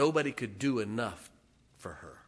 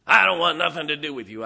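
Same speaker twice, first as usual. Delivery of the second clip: put-on loud, higher old lady shout; an old lady yelling her refusal; cranky refusal; 4 semitones higher, 14 dB louder, faster than usual. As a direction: shove him away with the voice.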